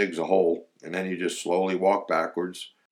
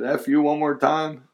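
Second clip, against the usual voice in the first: teasing; 8 semitones higher, 4 dB louder than usual. Retold or imitated. Eddie teasing Gary.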